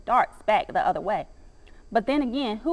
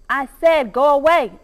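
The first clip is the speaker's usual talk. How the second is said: loud, higher, more urgent warning; urgent bark; 4 semitones higher, 7 dB louder than usual. A narrator's reading. Dog barks at a defiant squirrel, loud urgent warning. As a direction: shout it louder to drive the squirrel off. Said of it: "I bark with more urgency this time".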